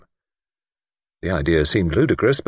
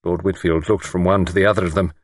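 plain reading voice

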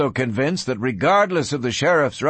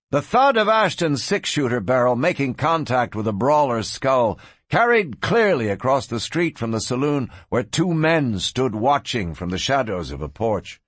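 usual speaking voice

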